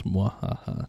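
whispered evil cackle; villainous mwahaha under breath; maniacal laugh. Pretend. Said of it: "Maniacal laugh".

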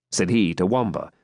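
usual speaking voice

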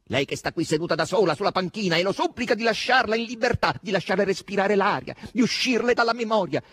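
high, loud, mounting tirade; full-voiced, high-pitched declaiming; declamatory outburst. A narrator's reading. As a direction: build it into an impassioned cry. Mounting taunting recitation.

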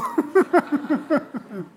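real chuckle at his own joke; a burst of laughter after the punchline; laughing. Real, not acted. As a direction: warm amused laugh.

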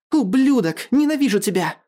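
high-pitched, furious outcry; angry scream. A narrator's reading. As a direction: lash out, pitch leaping up. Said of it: "закричала она".

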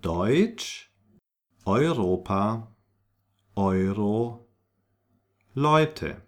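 Deutsch, Europa, Euro, Leute with oy; spaced repetition, Leute as "LOY-tuh". Shown as a demonstration. Teacher repeats the EU examples, clear German oy. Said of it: "I repeat".

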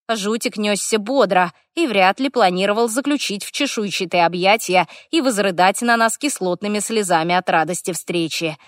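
ordinary delivery, nothing marked

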